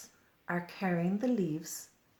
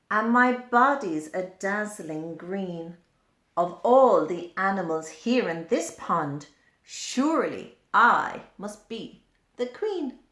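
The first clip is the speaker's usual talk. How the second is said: louder, a touch of a vain queen; proud dragonfly; 2 semitones higher, 8 dB louder than usual. Acted. Dragonfly boasting to the pond, louder and regal.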